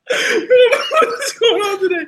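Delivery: high, slow, squealing through laughter; laughing. Real, not acted. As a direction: talk while cracking up. Laughing at himself.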